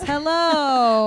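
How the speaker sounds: a stuffy TV weather lady greeting. Acted. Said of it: "That's my stuffy weather lady voice".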